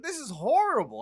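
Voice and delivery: high, loud disgusted wail. Retold or imitated. Complaining about the bad weed in Mexico, high and loud. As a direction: whine it in disgust.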